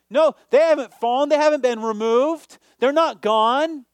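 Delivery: loud, high insistence; forceful denial. Acted. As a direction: hammer home each denial. Emphatic denial after shout.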